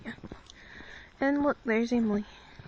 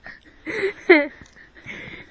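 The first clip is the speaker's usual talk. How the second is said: laughing; 8 semitones higher, 8 dB louder than usual. Real, not acted. Laughing fit continues.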